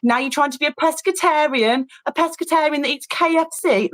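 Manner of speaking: high-pitched, needling taunt; mocking jibe. Husband getting personal about her diet, mocking jibe.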